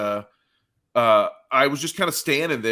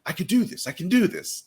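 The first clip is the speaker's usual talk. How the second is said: higher, eager voice talking himself into it; pumped-up self pep talk; 8 semitones higher than usual. Retold or imitated. To himself before a midnight match, a pumped-up pep talk. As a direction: psych yourself up like it's easy. Psyching himself up for late kickoff.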